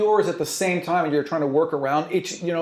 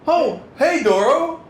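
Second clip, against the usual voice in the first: much higher, caught off guard greeting; startled, high-pitched hey; startled NPC; 12 semitones higher, 5 dB louder, slower than usual. Acted. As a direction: yelp a surprised hello. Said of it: "You're like".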